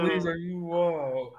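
usual speaking voice

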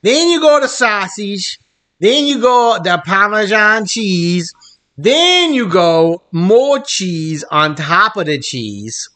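slow, hammed-up mock Italian accent; awful Italian accent. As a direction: drag out each ingredient in bad Italian accent. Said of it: "that fucking awful accent".

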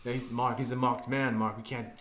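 mob boss at the dinner table; mobster voice. Pretend. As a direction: play a wiseguy talking business over dinner. Improvised mafia dinner skit.

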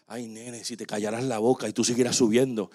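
low, soft laughing pastor; amused calm reply. Retold or imitated. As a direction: soothe the doubter with a chuckle. Pastor answering doubting son.

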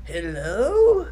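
put-on frail old man greeting, high and loud; quavering old man at the door. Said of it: "It's this super frail old man".

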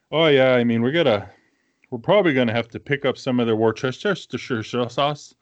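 imitating a thick Minnesotan accent; mock Minnesotan guy in the grocery store. Retelling a grocery store guy, mock Minnesotan accent. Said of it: "I mean, exactly like that".

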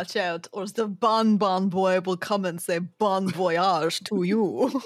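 wandering mock German-Russian accent; German sliding into Russian. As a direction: start German and drift into Russian, drawn out. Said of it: "German to Russian within".

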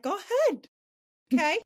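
high, breezy go ahead; mock eager compliance. Retelling obeying deities, high breezy compliance. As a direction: chirp it like an obedient student. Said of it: "I'm like".

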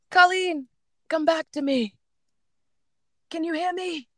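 high, soft, plaintive put-on voice; high-pitched celebrity impression, calling a lost friend; Lisa Vanderpump. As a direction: call out in a high socialite voice. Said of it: "this is my Lisa Vanderpump voice".

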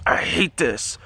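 lightly voiced demon; a hint of a demon's voice in the retelling; mildly put-on demon complaint; Nephilim demon voice. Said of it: "he says in the Nephilim demon voice".

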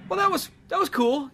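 high, lightly impressed; mild approval. Retold or imitated. Reader mildly liking the comic, high and light. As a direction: shrug and nod along.

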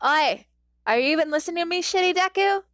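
yelling. A narrator's reading. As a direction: bark it at him like a bully kid. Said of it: "Kacchan yelled".

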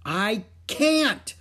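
put-on high, loud child's cry. A narrator's reading. Little girl crying to her mother, frantic. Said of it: "cried Ramona".

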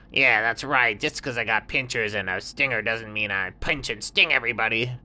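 high, sly little scorpion; high little scorpion voice, offering help. Acted. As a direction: squeak it out like a small sly critter.

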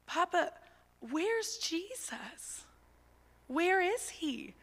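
put-on high, slow little girl asking; curious toddler voice, drawn out; imitating a toddler. Niece asks Papa, high slow curious toddler voice. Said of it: "and she goes".

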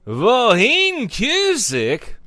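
Vohin Cusick stretched out slowly in a put-on Bosnian accent; lightly faked Bosnian accent on Vohin Cusick; made-up Bosnian accent. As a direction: drawl Vohin Cusick like an exaggerated Bosnian announcer. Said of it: "what's the Bosnian accent".

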